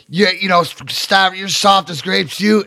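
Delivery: usual speaking voice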